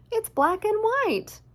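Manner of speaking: high, slow little cat voice; a high put-on cat voice. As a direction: squeak it like a small cat. Cat character's line.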